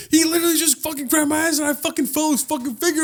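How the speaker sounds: high-pitched worked-up account; retold frantic explanation. Drunk man explaining to friends, high and worked up. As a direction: blurt it all out up high.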